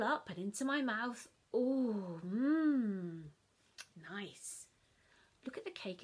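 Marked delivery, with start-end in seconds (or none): 1.5-4.7 mmm of delight. Acted. Child tasting cake crumbs, delighted mmm. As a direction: relish every crumb.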